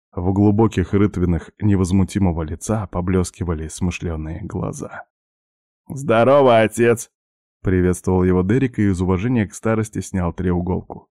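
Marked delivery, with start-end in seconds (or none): 5.9-7.1 hearty greeting. Acted. Derek greets old man loudly.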